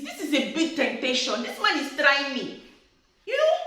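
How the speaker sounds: usual speaking voice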